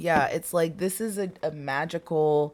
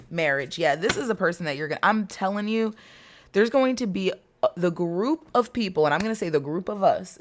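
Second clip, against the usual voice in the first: normal talking voice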